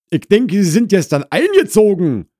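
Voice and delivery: high, loud housewife voice; Frau Krause challenging. Acted. Wife doubts the newcomer's account, challenging loudly. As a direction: challenge the visitor sharply, pitched high.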